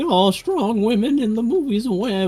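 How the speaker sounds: high-pitched mocking whine, kept light; mock whining. Mimicking complainers about women in movies, mock whining. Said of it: "there's the whining".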